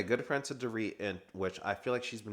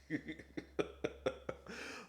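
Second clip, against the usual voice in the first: breathy laugh; 7 semitones higher, 10 dB quieter than usual. Real, not acted. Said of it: "That was kinda funny".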